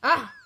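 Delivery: sharp frustrated shout; yelling at the game. Real, not acted. Losing at Flappy Wings.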